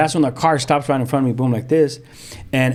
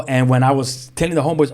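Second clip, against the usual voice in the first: usual speaking voice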